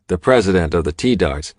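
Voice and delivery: plain reading voice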